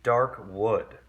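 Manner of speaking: plain reading voice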